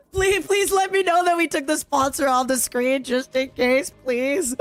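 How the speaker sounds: high, laughing pleading; laughing plea. Real, not acted. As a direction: beg through giggles.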